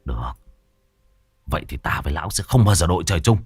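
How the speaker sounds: bitter, held-down fury; hissed through teeth. Acted. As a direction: vow revenge in a low hiss. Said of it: "rít qua kẽ răng".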